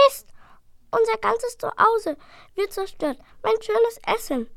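high dragon voice, wailing over his home; a small dragon mourning his food; upset little dragon. Acted. Little dragon to his brother, high and upset.

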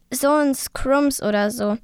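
usual speaking voice

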